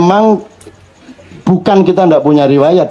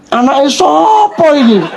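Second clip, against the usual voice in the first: higher, slow mock child asking whose kid this is; child's indignant voice; 9 semitones higher, slower than usual. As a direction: play the outraged little kid, laughing.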